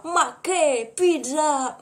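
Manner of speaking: slow, drawn-out mock Italian "Ma che pizza"; exaggerated Italian accent, very slow. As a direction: ham it up like a cartoon Italian. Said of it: "un mix d'italien ou de mafia".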